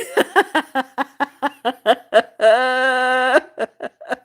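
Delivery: a long helpless laugh, almost teary; long laughing fit. Real, not acted. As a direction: laugh until you nearly cry. Long laugh after comment.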